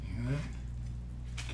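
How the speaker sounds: hushed, slow whisper while listening. Real, not acted. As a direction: breathe it so the sizzle stays audible. Listening to food sizzle.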